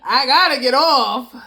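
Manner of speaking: loud, slow, laughing repeat. Real, not acted. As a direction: shout it through laughter.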